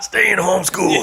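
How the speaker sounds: lightly voiced tough guy; a hint of a gruff Mister T. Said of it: "Mister T meant".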